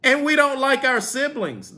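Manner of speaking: mock the claim; mocking read. Vlogger reading a commenter's claim, mocking.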